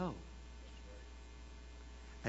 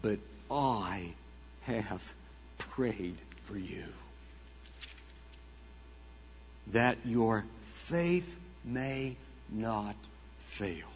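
tender Christ; 2 semitones lower, 5 dB quieter, much slower than usual. Acted. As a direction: lay each word down gently. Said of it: "the response of Christ".

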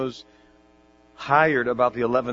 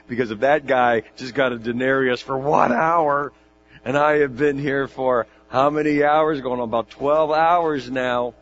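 excited build-up; 3 semitones higher, 8 dB louder than usual. Pretend. Listener imagines twelve hours' pay.

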